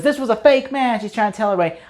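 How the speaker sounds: half-hammed angry outcry; mad protest. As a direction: protest to the crowd that it was rigged. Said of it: "that makes him mad".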